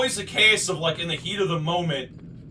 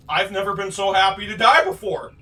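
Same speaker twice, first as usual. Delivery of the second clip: normal talking voice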